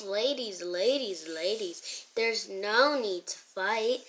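put-on low, slowing, placating boy voice; smooth peacemaker boy. Boy breaking up the girls' fight, placating. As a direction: bring it down low and soothe them.

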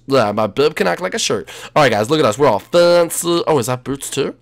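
ordinary talk, nothing marked

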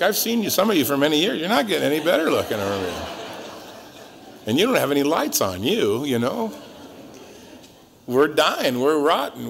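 normal talking voice

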